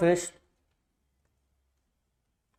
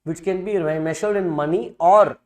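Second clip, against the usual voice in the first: dictating. Shown as a demonstration. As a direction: read it out phrase by phrase for notes. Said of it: "let me dictate the same thing".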